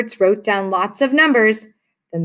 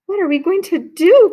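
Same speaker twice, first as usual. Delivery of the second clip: high, louder panicked mother; cried out; 9 semitones higher, 4 dB louder than usual. Acted. A hammed-up worried mother cry. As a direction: cry it out in alarm. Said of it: "cried Missus Cream".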